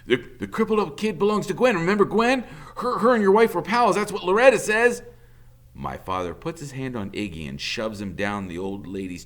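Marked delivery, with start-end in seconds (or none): 0.1-5.0 high, stammering babble; frightened accomplice rattling on; nervous rush. A narrator's reading. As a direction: get the words out and escape. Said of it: "Iggy is talking fast".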